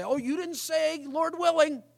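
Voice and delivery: high, put-on voice of a fussy church scold; mocking nitpicker. Preacher mocking a legalistic churchgoer, high sneering nag. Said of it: "you always have to say".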